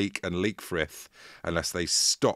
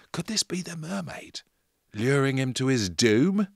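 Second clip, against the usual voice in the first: spooky whisper; 6 semitones higher than usual. A narrator's reading. Spooky storytelling aside.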